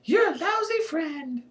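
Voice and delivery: very high squealed insult; high-pitched indignant squeal; screamed addition. A narrator's reading. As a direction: hurl it in a shrill voice. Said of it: "and then added".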